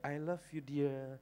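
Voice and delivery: slow sing-song chapel greeting; affectionate greeting. Retold or imitated. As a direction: draw out each word fondly. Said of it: "saya selalu bilang begini".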